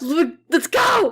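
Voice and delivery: high, drawn-out rallying yell; hyped-up shout. Real, not acted. Voice actor hyping up the director, shouting excitedly. Psyching up before recording.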